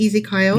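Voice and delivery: Ezekiel said as "ee-zee-KYLE"; nickname pronunciation. Shown as a demonstration. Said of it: "I meant to say Ezekiel".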